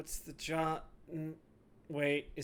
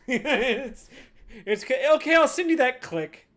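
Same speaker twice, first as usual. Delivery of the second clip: loud, high, laughing imitation; gleeful high-pitched echo; mocking mimicry; 9 semitones higher, 11 dB louder than usual. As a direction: mimic the flustered goodbye through laughter. Laughing, mimics the other's sign-off.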